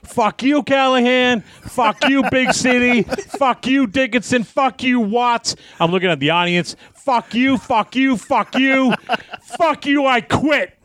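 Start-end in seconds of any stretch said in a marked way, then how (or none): 0.0-5.5 high-pitched mock tirade, name after name; re-enacted angry yelling at no great volume; imitated yelling. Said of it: "So I start yelling from the S T F". 6.9-10.8 very high, mock-furious outburst at the audience; re-enacted angry quitting holler; imitated yelling. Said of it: "So I start yelling".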